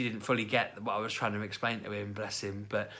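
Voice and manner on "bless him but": ordinary talk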